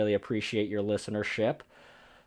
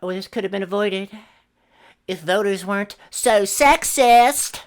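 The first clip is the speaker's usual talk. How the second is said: mocking liberal voice; 8 semitones higher, 5 dB louder than usual. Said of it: "saying shit like".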